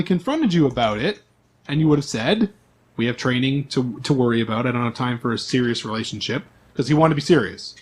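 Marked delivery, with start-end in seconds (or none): none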